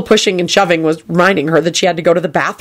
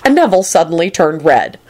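usual speaking voice

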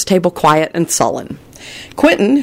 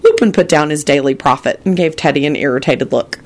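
usual speaking voice

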